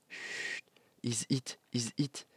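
hushed whisper, barely voiced; whispering while hiding. Real, not acted. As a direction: whisper so nobody hears you. Hiding from the other players.

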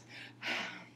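heavy voiceless sigh; exasperated sigh. Real, not acted. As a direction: let out a frustrated breath. Stops herself mid-rant.

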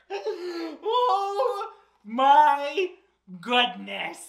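usual speaking voice

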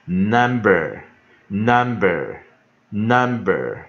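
"number" very slow and loud, stress on NUM; slow, emphatic classroom repeats of "number"; pronunciation demo. Shown as a demonstration. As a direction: model the whole word "number", evenly repeated. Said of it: "所以我们就".

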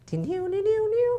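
high-pitched startup chime; imitated laptop sound. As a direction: sing out electronic boot-up notes. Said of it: "then I heard this sound".

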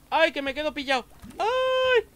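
sudden high panicked cry; startled yelp. Real, not acted. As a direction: yelp in alarm. Gets stuck, cries out.

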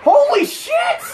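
very high, drawn-out shriek of shock; shocked yelp. Real, not acted. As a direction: yelp it slowly in alarm. Startled during game.

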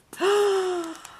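sharp, high gasp of delight. Real, not acted. Crafter discovers a handmade gift, delighted gasp. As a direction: gasp at a lovely surprise.

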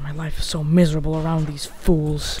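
quiet gloom. Acted. Moping complaint about companions.

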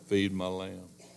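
slow, low, soft command; Jesus quietly telling Peter, slow and low; tender command. Acted. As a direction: lay the words down gently and slowly.

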